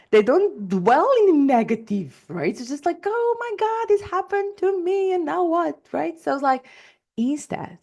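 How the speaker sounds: normal talking voice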